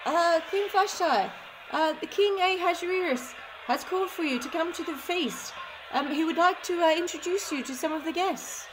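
high, hesitant servant voice; hunchback assistant. Acted. Messenger speaks to queen.

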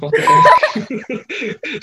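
high, loud, slow drawn-out what the fuck; a laughing, mock-outraged what the fuck; shocked outburst. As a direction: stretch it out in shocked disbelief. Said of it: "He was like".

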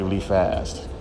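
ordinary talk

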